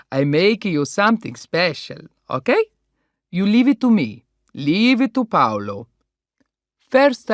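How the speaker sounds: put-on loud, jovial Italian-accented host; Italian restaurant owner. Paolo promising the birthday girl a treat, jovial Italian accent.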